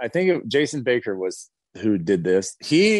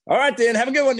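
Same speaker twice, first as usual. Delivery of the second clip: much higher, louder rushed goodbye; hurried farewell; 12 semitones higher, 4 dB louder, much faster than usual. Retold or imitated. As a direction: blurt the goodbye to get the last word. Punter rushing last farewell.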